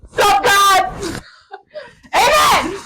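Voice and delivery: punchy, over-eager shout to heaven; mock panicked prayer. Joking about panicking when asked to pray, mock shout. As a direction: burst out high and loud. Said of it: "I'll be like".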